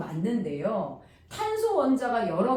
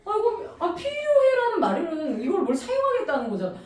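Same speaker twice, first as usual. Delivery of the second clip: higher, pleading mock husband; imitating him fumbling to explain, higher; fiancé hedging about the diamond, higher; husband's hesitant voice; 9 semitones higher, 4 dB louder than usual. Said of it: "retold quarrel with fiancé".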